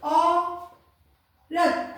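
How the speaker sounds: औरत very slowly, long au then the rest apart; drawn-out dictation. Shown as a demonstration. Teacher dictating a word to pupils, औरत in slow drawn-out parts. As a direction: hold the औ of औरत, then pause. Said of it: "अगर मैं आपको बोलूं".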